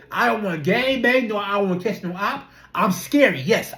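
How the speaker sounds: ordinary talk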